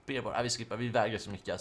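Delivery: ordinary talk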